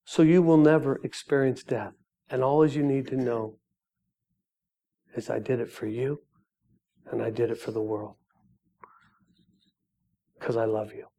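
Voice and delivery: hushed, gentle voice of God; tender reassurance. Acted. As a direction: soften right down, with space between phrases. God reassures Mike with love.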